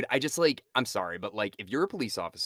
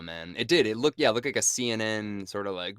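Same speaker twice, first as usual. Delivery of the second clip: normal talking voice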